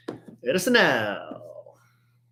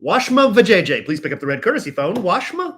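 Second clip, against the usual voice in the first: higher, fast announcer page; airport page; 6 semitones higher, 3 dB louder than usual. Pretend. Mock airport paging announcement.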